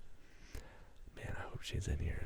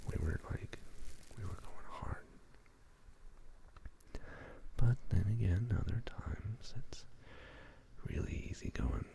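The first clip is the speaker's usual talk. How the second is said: hushed, unhurried ASMR whisper. Real, not acted. Host greeting stream viewers in an ASMR whisper. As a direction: whisper close to the listener, lingering on words.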